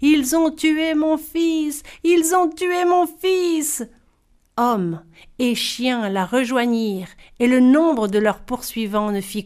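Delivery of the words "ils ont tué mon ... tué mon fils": high, drawn-out cry of a bereaved mother; wailing cry. A narrator's reading. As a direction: howl it out slowly, high-pitched. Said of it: "en hurlant". (hurlement aigu et traînant)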